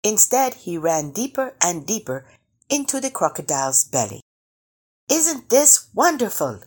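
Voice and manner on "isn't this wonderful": high, delighted storybook shout. A narrator's reading. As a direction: exclaim with glee, pitched well up. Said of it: "he shouted".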